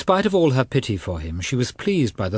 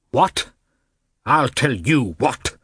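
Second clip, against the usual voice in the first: louder, higher outburst of an angry father; shouting; 4 semitones higher, 4 dB louder, slower than usual. A narrator's reading. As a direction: snap back at the wife. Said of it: "shouted the prince".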